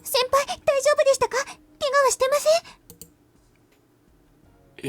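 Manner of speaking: put-on high, concerned young girl; worried girl voice. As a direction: ask anxiously in a girlish voice. Worried junior checks on seniors.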